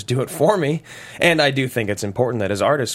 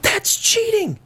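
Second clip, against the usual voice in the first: high indignant yelp; outraged; slower than usual. Acted. Husband outraged at wife drugging kids. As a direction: protest in shock.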